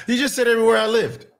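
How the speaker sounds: amused disbelief, words tumbling out; laughing. Real, not acted. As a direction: blurt it out through real laughter. Laughs at accurate accent guess.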